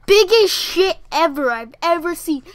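loud, high outburst; loud amazement. Real, not acted. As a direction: announce it loudly in disbelief. Exclaims about the dog's mess.

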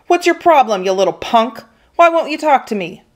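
high-pitched angry demand; angry bully. Acted. Angry bully grabbing a kid's shirt, confronting. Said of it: "The face was pinched and pale and angry".

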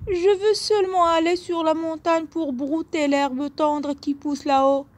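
voix haute et menue de petit bouc; petit bouc, voix aiguë. A narrator's reading. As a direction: plaider d'une toute petite voix aiguë. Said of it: "de sa voix aiguë". (petit bouc à la voix fluette et aiguë)

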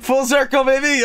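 high, excited call; triumphant. Real, not acted. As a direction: crow it out in triumph. Triumphant shout.

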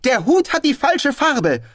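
high, loud, beaming excitement; strahlend. A narrator's reading. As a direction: announce the discovery in delight. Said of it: "Er strahlte". (strahlend und laut verkündet)

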